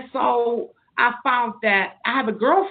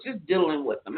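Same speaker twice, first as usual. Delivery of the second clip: diddling with them, repeated softer and lower; soft aside; 6 semitones lower, 6 dB quieter than usual. Real, not acted. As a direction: drop it into a soft, low afterthought.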